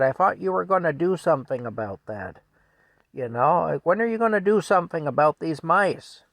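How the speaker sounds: exasperated demand; yelling wife. Retold or imitated. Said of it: "she yells at Frank".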